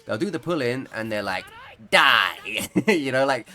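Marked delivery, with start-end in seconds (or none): 1.9-2.7 loud, hammed-up villain cry; Darth Sidious. Villain about to electrocute someone, loud mock-menacing shout. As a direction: shout it like an evil sorcerer striking. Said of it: "like they're Darth Sidious about to electrocute".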